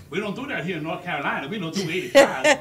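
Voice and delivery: soft imitation of the little cop; softly put-on cop voice; mock state trooper. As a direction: play the tiny trooper, hushed. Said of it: "he was like".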